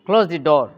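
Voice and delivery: loud order falling on "door"; falling tone command. Shown as a demonstration. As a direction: give a firm command, pitch dropping on "door". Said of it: "command type of sentences take falling tone".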